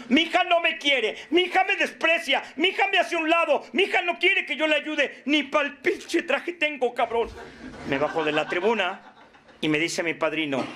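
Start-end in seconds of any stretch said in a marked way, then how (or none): 0.1-7.3 high-pitched, hammed-up grievance; mock complaining. Parent griping to the group about the daughter, mock complaint. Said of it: "me quejé en el grupo".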